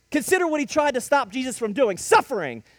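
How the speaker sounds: normal talking voice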